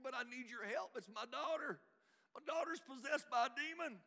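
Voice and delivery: high, loud cry for help; a mother's acted desperate plea; desperate pleading. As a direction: plead at the top of your range, building in force. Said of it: "she came to him pleading".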